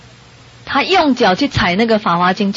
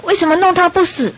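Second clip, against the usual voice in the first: higher-pitched, indignant demand; mock-angry King of Hell; 8 semitones higher than usual. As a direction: act out a mildly angry underworld king demanding an answer. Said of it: "那个阎王看到我就很生气". (模仿阎王生气地高声质问)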